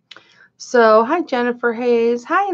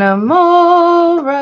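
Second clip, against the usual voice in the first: drawn-out greeting; 9 semitones higher, 8 dB louder, much slower than usual. Real, not acted. Greeting a viewer.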